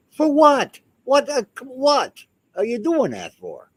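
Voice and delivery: what on earth for, hurled out; high, loud disbelief at a pointless knock; incredulous outburst. Pretend. Incredulous ghost retort.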